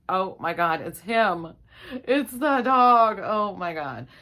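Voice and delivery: loud, drawn-out shocked cry; it's the dog, horrified discovery; shocked realization. Retold or imitated. Wife discovering the dog smells, loud shocked cry.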